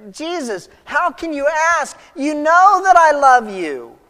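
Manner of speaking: high, pleading, building to loud; hurt protest. Acted. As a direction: protest in anguish at being doubted. Acted hurt Peter protesting to Jesus.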